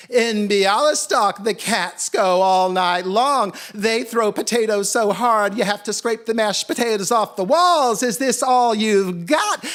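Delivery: loud, high taunting holler; scornful shouted challenge; yelling out. A narrator's reading. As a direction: goad the exhausted cats at full volume. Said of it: "And he yelled out".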